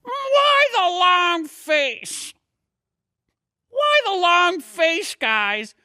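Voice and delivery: very high, slow cartoon puppet voice; squeaky sock puppet teasing the grieving kids; a goofy hand-puppet sidekick cracking jokes; Otto the sock puppet. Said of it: "my buddy Otto had some questions for you".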